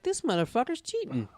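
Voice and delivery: high-pitched retold indignation; mock accusation. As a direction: accuse in playful outrage. Retelling own accusing reaction.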